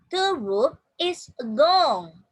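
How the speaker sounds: slow, loud model sentence; teacher reading very slowly and loudly. Shown as a demonstration. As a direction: draw each word out for learners to copy. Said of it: "Please repeat after me".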